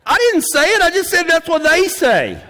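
high-pitched protest of innocence; mock defensive. Preacher disowning his joke to the audience, mock defensive. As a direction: protest way up high, as if wrongly accused. Jokingly disowns the saying he quoted.

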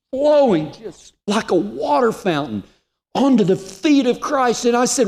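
very high, strained, emotional preaching; preacher at the tearful peak of the story; impassioned testimony. Real, not acted. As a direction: pour it out at a high pitch, near breaking.

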